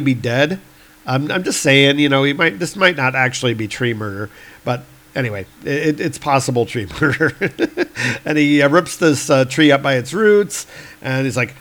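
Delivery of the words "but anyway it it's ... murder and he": usual speaking voice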